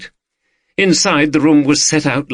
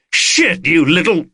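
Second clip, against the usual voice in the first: high, startled curse; pained exclamation; 7 semitones higher than usual. A narrator's reading. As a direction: curse at a sudden scratch, cut short. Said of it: "exclaimed Carter as he quickly drew".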